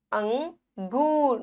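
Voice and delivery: अंगूर slowly, split as अं, गूर; अंगूर broken into two drawn-out parts; nasal n sound. Shown as a demonstration. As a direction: hum अं through the nose in अंगूर. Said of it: "नाक से आ रही है".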